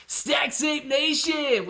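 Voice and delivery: loud high shout-out; excited shout-out; hype shout. Real, not acted. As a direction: call the donor out loud. Shouting out a donor.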